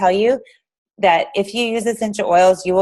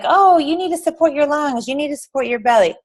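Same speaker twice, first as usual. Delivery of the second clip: chirpy sales pitch; 7 semitones higher than usual. Retold or imitated. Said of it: "We're like".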